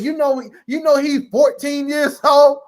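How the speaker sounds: high, slow, loud outraged mom. Retold or imitated. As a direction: drag out the scolding loudly. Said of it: "my mom all loud".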